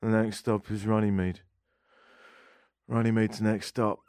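monotone, joyless announcer; grim transit announcement. Acted. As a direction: drone out the next stop. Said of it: "The grimmest announcement".

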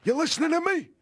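high, strained mobster impression; Marlon Brando. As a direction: make a mock Godfather threat. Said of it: "That's sort of the Godfather".

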